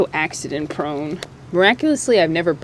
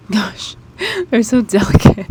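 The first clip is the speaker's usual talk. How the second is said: laughing; 4 semitones higher, 8 dB louder than usual. Real, not acted. Laughs handling seedlings.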